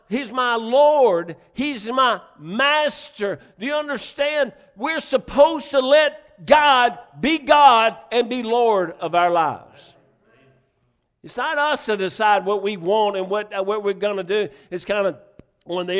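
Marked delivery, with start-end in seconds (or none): 0.1-9.9 slow, loud, drawn-out proclamation; slow emphatic preaching. Acted. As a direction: pound each title home slowly. Preaching climax.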